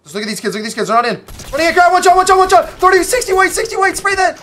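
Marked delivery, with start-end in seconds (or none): 1.5-4.4 high, loud hype callouts mid-fight; real gamer hype shouting over teammates; excited shouting. Real, not acted. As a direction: yell the callouts, pumped up.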